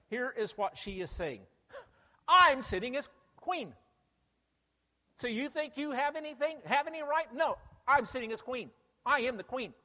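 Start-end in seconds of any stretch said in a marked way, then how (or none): 2.3-9.7 loud, high, proud queen proclaiming herself; boastful queen. Acted. Preacher voicing the proud queen, boasting loudly. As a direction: crow it like a queen on her throne. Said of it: "then you're screaming at the end of it".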